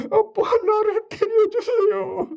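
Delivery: put-on very high, slow, pleading child; mock tearful little boy. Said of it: "그러면 울던 애가".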